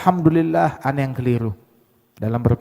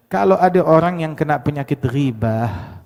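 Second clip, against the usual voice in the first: ordinary delivery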